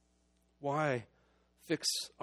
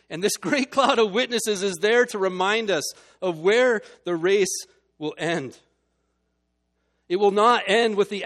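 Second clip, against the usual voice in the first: louder, higher, driving toward the climax; fervent preaching; 6 semitones higher, 10 dB louder than usual. Acted. Preacher rallying the congregation, fervent full-voiced preaching. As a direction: preach it at full force to the crowd.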